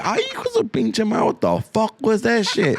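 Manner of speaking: high-pitched, startled driver's outburst; mock startled. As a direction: react as if a clown jumped out. Startled driver reacts to clown.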